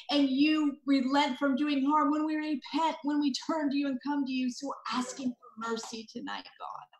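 usual speaking voice

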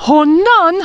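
honnan with a clear breathy H at the start. Shown as a demonstration. Teacher models honnan with an aspirated H. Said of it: "avec un H".

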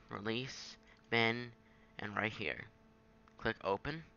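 ordinary delivery

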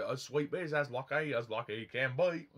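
lightly voiced Cockney that drifts Australian; a mock Cockney sung line with an Australian lilt; Cockney sounding Australian. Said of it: "it does sound Australian".

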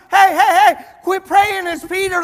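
loud, high-pitched excited shouting; excited interruption. Retold or imitated. Rhoda running in to those praying, excited shout. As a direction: cut in over everyone, urgent and thrilled. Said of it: "she gets all excited".

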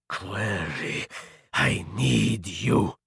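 slow, low, husky rasp. A narrator's reading. Wounded vampire pleading with Naomi, rasping. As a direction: rasp it out softly, drawing out each word. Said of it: "he rasped".

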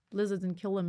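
usual speaking voice

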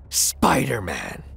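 low, drawn-out villain voice; Scorpion calling out. A narrator's reading. Scorpion facing the web crawler, calling out low and slow. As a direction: draw the name out in a deep villain's voice. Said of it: "The Scorpion would call out".

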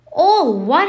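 outraged giant's roar, high and loud; booming, incredulous shout; thundered. A narrator's reading. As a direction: thunder it in disbelief. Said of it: "Hagrid thundered".

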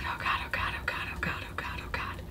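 whispered oh God chant; panicked whisper. Real, not acted. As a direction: breathe it under your breath.

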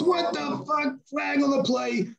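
hammed-up furious yell at Chad; mock outraged yelling.

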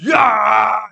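huge, high roar re-enacted; mighty roar. Narrator re-enacting his roar at the ghost in his dream, mighty roar. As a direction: roar it out with full power. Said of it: "इतना जोर से दहाड़ा एकदम".

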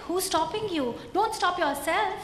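ordinary talk, nothing marked

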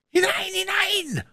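very high, eager yell; furious bellow. A narrator's reading. Blind beggar ordering men inside, furious bellow. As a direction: drive the men on angrily. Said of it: "lauter und heller, wie wenn er eifrig und wütend wäre".

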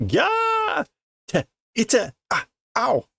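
high pained cries of ow; mock pained squeals; hurt yelps. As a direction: yelp as if smacked with a teddy. Voicing Miura being smacked.

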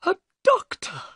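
very high, nervy exclamation; excited cry. Acted. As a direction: cry out as an excitable little man. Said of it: "cried Thaddeus Sholto excitedly".

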